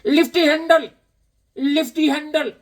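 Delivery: high, loud voice over an intercom; Bobby voice. Retold or imitated. Imitating Bobby on the gas station intercom. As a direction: bark it high like a bored clerk. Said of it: "he's on the intercom".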